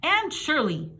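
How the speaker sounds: loud, high teacher's shout; angry teacher shouting. A narrator's reading. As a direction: snap the name across the classroom. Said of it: "shouted the teacher".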